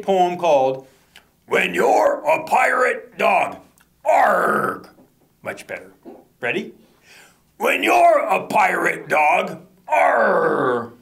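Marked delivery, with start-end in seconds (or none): 1.5-4.9 slow, hammed-up pirate with an arg. Shown as a demonstration. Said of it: "A little more pirate-like this time". 7.6-10.9 slow, hammed-up pirate chant; pirate refrain.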